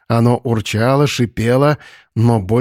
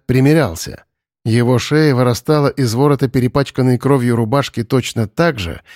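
ordinary delivery

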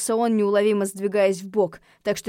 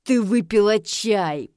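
slow, drawn-out growl; bare the teeth and press the point; menacing snarl; 4 dB louder, slower than usual. A narrator's reading. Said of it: "оскалился Винсент".

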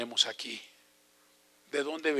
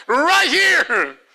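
put-on high, loud child's voice pointing the way; little girl in English; 11 dB louder than usual. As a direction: call out like an eager four-year-old. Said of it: "me decía en inglés".

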